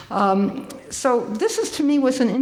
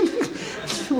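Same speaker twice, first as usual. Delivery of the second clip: breathy laugh; 8 semitones higher, 5 dB quieter than usual. Real, not acted.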